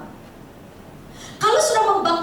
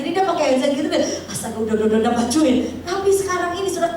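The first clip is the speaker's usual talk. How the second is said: ordinary talk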